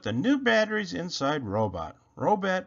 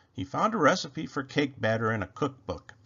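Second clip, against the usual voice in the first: ordinary delivery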